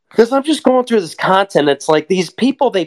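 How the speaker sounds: loud, high ranting burst; ranting shout. Real, not acted. Ranting at online posers, loud high outburst. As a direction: blast it out, fed up.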